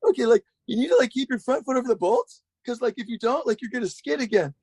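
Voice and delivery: high, cracking guru impression; Roddy Mullen as voice-cracking Yoda. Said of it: "like a voice cracking version of Yoda".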